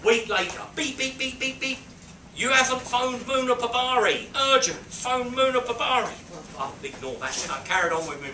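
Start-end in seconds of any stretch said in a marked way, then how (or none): 0.8-1.8 high, rapid run of beeps; imitated pager beeps. Storyteller's pager goes off one day, high beeping. 2.4-6.1 high, loud pestering announcement; insistent pager message. Retold or imitated. Pager nags him a week later, high loud insistence.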